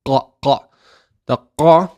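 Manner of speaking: QA made far back at the top of the throat; throaty qaf. Shown as a demonstration. Teacher showing learners the qaf at the top of the throat, throaty demo. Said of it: "at the top of the throat".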